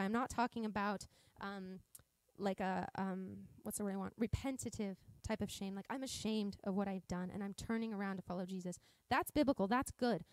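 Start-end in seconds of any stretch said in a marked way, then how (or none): none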